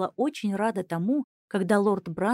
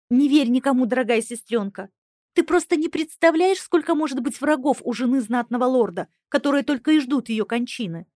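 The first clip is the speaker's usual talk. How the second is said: higher, louder urgent exclamation; loud exclaiming; 4 semitones higher, 6 dB louder than usual. Acted. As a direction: urge her loudly. Sister exclaims loudly.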